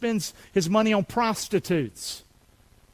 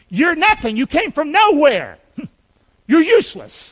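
loud, high-pitched mock accusation; Satan's accusation; 6 semitones higher, 5 dB louder than usual. Preacher voices Satan belittling the listener, loud accusation. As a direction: hurl each verdict out, high and forceful. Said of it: "That's what Satan says".

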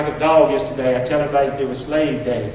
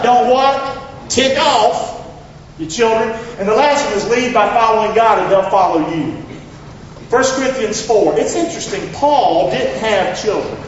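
raised, emphatic sermon delivery; higher-pitched, punchy preacher cadence; preaching; 6 semitones higher, 3 dB louder than usual. Real, not acted. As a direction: drive the points home from the pulpit.

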